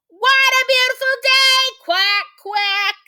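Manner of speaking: very high, loud quacking voice; shouting duck. Acted. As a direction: holler like an excited, noisy duck. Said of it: "shouts Dottie".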